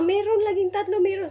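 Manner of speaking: high, insistent mock voice of the husband; mimicking husband. Mimicking her husband's insistence, high and mocking. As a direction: pitch it up and insist there are three. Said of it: "nagkuhan siya na".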